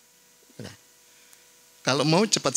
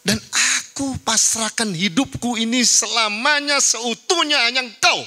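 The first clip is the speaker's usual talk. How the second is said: fervent prayer; 9 semitones higher than usual. Shown as a demonstration. Preacher voicing a surrendering prayer.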